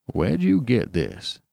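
slow, astonished demand. A narrator's reading. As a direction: question the child slowly and pointedly. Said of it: "he demanded".

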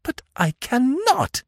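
high, loud, drawn-out objection; distressed, slow refusal; objecting. A narrator's reading. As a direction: object desperately, pitched very high. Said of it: "she objected".

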